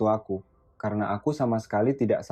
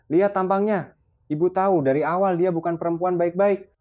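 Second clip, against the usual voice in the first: much higher, loud sneering insult; contemptuous outburst; 8 semitones higher, 4 dB louder than usual. A narrator's reading. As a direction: spit the insult out high and loud. Mother points at daughter-in-law, insulting. (ibu mertua menghina dengan suara melengking)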